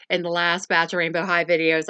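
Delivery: ordinary delivery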